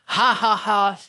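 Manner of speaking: loud, booming villain laugh; hearty laugh. A narrator's reading. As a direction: laugh big and smug. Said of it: "laughed heartily".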